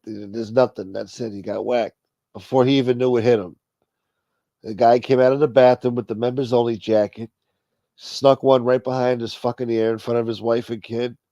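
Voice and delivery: ordinary talk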